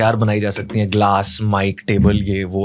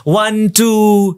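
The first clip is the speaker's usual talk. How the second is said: mocking soft instructor; 8 semitones higher, much slower than usual. Mocking other schools' gentle drills, high sing-song counting. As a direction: parody a gentle martial arts teacher. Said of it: "हम नहीं कराते वो".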